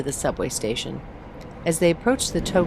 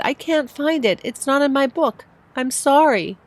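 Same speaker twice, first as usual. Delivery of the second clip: put-on high, upset little boy near tears; apologise, holding back tears; tearful child; 10 semitones higher, 4 dB louder than usual. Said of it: "He started to sniffle".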